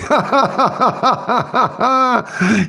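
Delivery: a long, high run of laughter at his own pun; high cackling laugh. Real, not acted. Streamer cracking up high at his own seafood pun.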